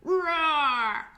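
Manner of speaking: put-on playful roar for children; lion roar. Shown as a demonstration. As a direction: show the kids a lion roar. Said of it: "A lion roars just like this".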